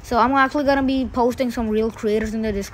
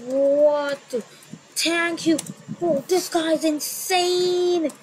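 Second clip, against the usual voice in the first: stunned; 4 semitones higher, much slower than usual. Retold or imitated. Stunned by huge donation.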